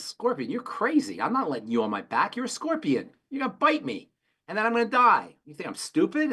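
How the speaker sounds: the frog refusing the scorpion, high and scornful; indignant frog. Retold or imitated. Frog voiced in fable.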